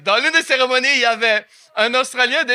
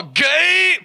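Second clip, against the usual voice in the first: mock shout; 7 semitones higher than usual.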